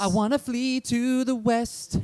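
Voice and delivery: high, slow, hammed-up patriotic voice; mock heroic boy. Mocking a young Roosevelt who longs for the West, high and grand. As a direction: swell with boyish glory.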